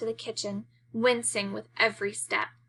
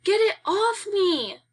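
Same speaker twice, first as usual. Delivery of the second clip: slow, drawn-out high shriek, much louder; screaming; 11 semitones higher, 4 dB louder, slower than usual. Acted. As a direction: wail it slowly in terror, high and loud. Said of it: "Aunt Minnie screams".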